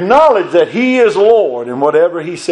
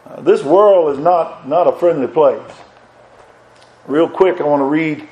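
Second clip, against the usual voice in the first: usual speaking voice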